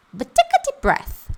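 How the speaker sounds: mock the blur of fast native speech; comic high-pitched voice; high squeaky mock native. Shown as a demonstration. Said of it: "Que diacho é isso".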